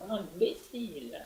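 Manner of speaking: lightly voiced mad scientist, slow; a hint of a raving mad scientist. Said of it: "cette voix du savant fou".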